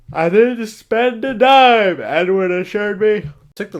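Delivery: high, loud, slow, hammed-up; mock Edward. Mocking the vampire boyfriend, shrill slow sing-song. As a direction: overplay the sweet boyfriend.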